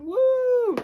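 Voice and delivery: high, loud little whoop of joy; excited cheer. Real, not acted. Cheering to viewers about no book ban, excited whoop. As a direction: celebrate the news with a whoop.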